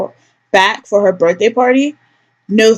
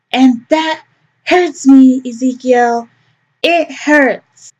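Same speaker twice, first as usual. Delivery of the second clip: higher, wounded mom; lightly higher wounded mother; hurt mother; 4 semitones higher, much slower than usual. Acted. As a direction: play the victim.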